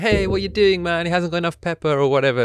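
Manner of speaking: put-on high, loud, fast Italian accent complaint; excited hammed-up Italian accent; mock Italian accent. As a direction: complain about the pepper like a passionate Italian. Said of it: "I can't do Italian accent".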